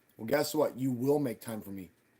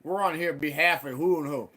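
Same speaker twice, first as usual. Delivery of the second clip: loud accusing; 5 semitones higher, 6 dB louder than usual. Retold or imitated. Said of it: "I said".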